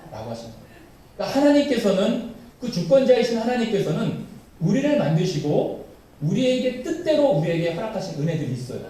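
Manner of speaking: usual speaking voice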